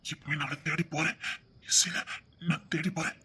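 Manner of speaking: low, hushed and halting; quiet resolve. Acted. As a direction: say it softly, almost to yourself. Resolving alone to search for her.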